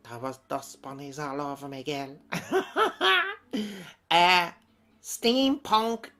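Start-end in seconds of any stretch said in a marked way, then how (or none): 2.2-4.1 a high burst of laughter; real giggle fit. Real, not acted. Laughs again.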